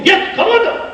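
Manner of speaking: a sharp high urgent "come under"; urgent call. Retold or imitated. Father urges the frail man under him, a high urgent call. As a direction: pull him down quickly to shield him.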